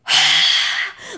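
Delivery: big, long exaggerated sigh; huge mock sigh. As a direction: imitate a put-upon worker's heavy sigh. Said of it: "like, the biggest sigh on the planet".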